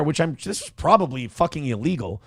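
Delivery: ordinary talk, nothing marked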